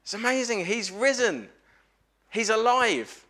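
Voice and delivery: high-pitched, amazed proclamation; thrilled and emphatic, pitched well up; excited. Real, not acted. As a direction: announce amazing news with high, lifted excitement. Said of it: "Said without a huge amount of excitement".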